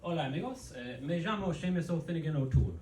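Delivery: Spanish with an Irish leprechaun lilt. Acted. Comic trying a new impression, Spanish leprechaun accent. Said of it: "That's a Spanish leprechaun".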